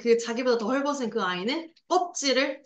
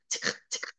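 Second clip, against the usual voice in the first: imitated camera click; 8 dB quieter, much faster than usual.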